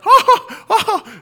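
high, loud stunned oh; mock disbelief. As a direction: gasp it out, laughing.

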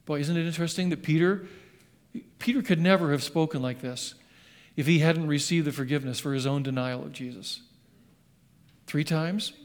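normal talking voice

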